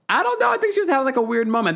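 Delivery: squeaky high I don't know; playful high shrug. Real, not acted. Answering a viewer's question with a squeaky high shrug.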